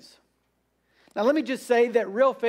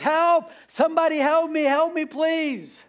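high-pitched mock cry for help; pleading shout, pitched well above normal; acted scream for help; 7 semitones higher than usual. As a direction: call out like someone dangling from a cliff. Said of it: "he just screams there".

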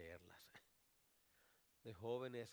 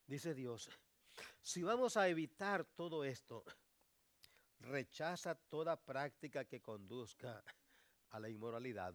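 loud, higher preaching build; fiery pulpit delivery; preaching at full force; 4 semitones higher, 10 dB louder than usual. Acted. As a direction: preach it out to the congregation. Preacher raising his voice.

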